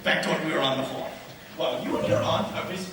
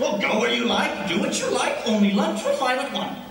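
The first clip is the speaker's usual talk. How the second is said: raised, high-pitched insistence; exasperated order; 6 semitones higher, 4 dB louder than usual. Acted. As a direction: brush aside the servant's objection impatiently. Overrides servant's objection.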